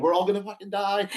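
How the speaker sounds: high, loud mock panic about dying. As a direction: squeal it like a hammed-up passenger certain of doom. Inner panic during turbulence.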